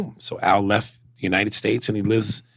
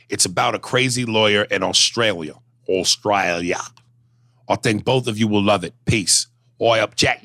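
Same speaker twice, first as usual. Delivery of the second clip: ordinary talk, nothing marked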